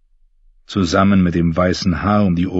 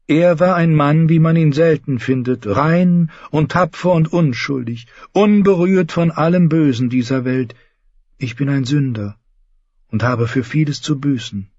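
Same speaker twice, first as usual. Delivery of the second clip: put-on higher, devout young knight; Lancel; 9 semitones higher than usual. Lancel confessing his new faith to James, earnest. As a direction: speak like a pious, fervent cousin.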